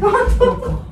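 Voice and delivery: very high, loud, amused surprise; laughing disbelief. Real, not acted. Host hearing a colleague's cat eats spiders, laughing disbelief. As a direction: blurt it out, amazed and laughing.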